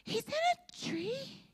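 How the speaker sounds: put-on high, soft, worried little girl; timid neighbor kid reporting trouble.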